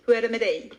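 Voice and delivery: "Hur är det med dig?" run into one quick word, stress on dig; natural Swedish "Hur är det med dig?" landing on a long "dig"; natural Swedish run-together. Shown as a demonstration. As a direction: model "Hur är det med dig?" fluently, short words merged. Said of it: "the same way as I'm saying it".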